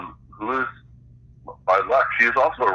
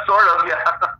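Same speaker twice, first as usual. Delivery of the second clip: laughing agreement, pitched up; amused laughing reply; 10 semitones higher than usual. Real, not acted. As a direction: chuckle through the words. Laughing at the comparison.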